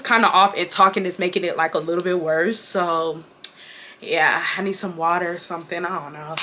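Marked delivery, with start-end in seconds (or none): 2.6-6.3 short of breath. Real, not acted. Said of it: "my breathing is kind of off".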